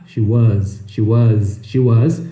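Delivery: SHE WAS low, as "shee wuhz"; voiced Z on every WAS; pronunciation drill. Shown as a demonstration. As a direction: repeat SHE WAS with clear Z ending. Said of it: "Então você tem que treinar".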